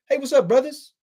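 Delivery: hammed-up jokey impression of a guy; mock impression. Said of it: "he's like making a joke and he's like".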